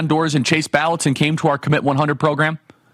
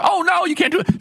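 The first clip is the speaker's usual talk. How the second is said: mock scolding critic; 14 semitones higher than usual. Mocking critics of the Russia trip.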